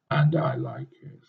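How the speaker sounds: breathy relishing whisper. A narrator's reading. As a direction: hush it like a secret pleasure.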